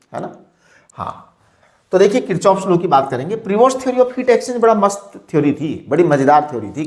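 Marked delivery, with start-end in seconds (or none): none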